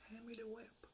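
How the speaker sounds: mock seductive hush. Repeating her dom line to the man, hushed and teasing. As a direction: lean in and purr the order.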